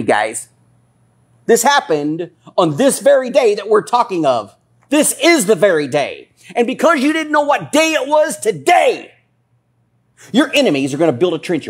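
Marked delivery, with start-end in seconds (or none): 1.4-11.7 high, forceful pulpit cry; full-force preaching. Real, not acted. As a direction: drive it home at the top of your range. Climax of the sermon.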